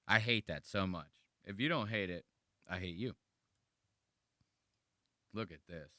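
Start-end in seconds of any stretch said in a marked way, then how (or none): none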